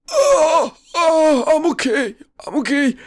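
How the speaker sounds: high, loud winded moan; imitated moaning. Retelling the crash as the hurt friend, high mock moaning. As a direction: mimic a friend groaning through pain, voice way up. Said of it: "He's just moaning".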